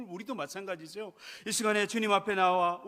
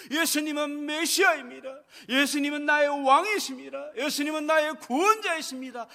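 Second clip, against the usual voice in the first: raised, ringing declaration; loud confession; 6 semitones higher, 7 dB louder than usual. Acted. A mother proclaims faith in Jesus, loud and fervent. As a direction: proclaim it like a vow. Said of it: "여인의 입술의 작은 메시지요, 고백이었어요".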